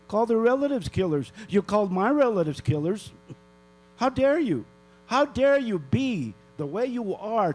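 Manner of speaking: real outrage at the council; outraged. Real, not acted. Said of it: "I'm really pissed off".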